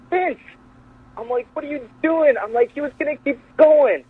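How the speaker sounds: high-pitched, incredulous call; mock exasperated protest. Retelling a protest to the producer, high mock exasperation.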